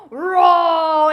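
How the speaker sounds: big, loud drawn-out roar; playful full-volume lion noise. Acted. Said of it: "lots of animal sounds in this song".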